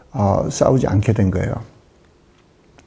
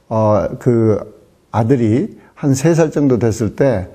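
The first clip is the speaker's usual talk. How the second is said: ordinary delivery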